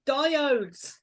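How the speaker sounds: high, loud nagging about the draft; hammed-up householder's complaint; mock scolding shout.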